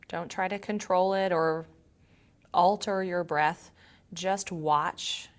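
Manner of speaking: calm, quiet meditation voice with long pauses; guided meditation. Acted. Yoga teacher leading students to notice body and breath, calm guided meditation. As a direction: guide them gently inward, leaving long silences.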